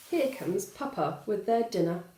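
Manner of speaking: plain reading voice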